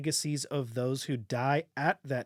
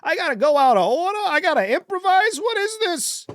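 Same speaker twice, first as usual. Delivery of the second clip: high, loud sitcom standup whine; exasperated comic rant, pitched up; Jerry Seinfeld voice; 14 semitones higher, 8 dB louder than usual. Pretend. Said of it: "Why am I talking like Jerry Seinfeld?".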